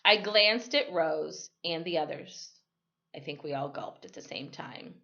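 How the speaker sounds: plain reading voice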